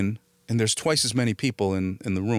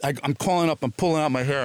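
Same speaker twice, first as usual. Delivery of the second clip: normal talking voice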